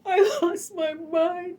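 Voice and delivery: real tears, voice cracking up high; breaking down while telling it; crying. Real, not acted. Telling her audience how she lost her mind, sobbing. Retelling news of grandmother's death.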